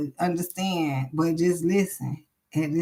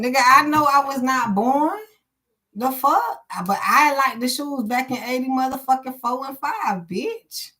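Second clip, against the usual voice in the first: indignant outburst; 6 semitones higher than usual. Real, not acted. Indignant rant at [name].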